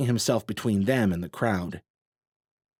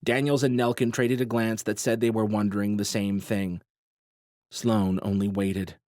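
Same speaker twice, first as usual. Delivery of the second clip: ordinary delivery, nothing marked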